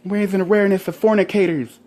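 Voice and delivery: high, loud goofy announcement; mock campaigner. Pretending to campaign in a helmet, loud goofy proclamation. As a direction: ham up a proud awareness campaigner. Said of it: "Be like".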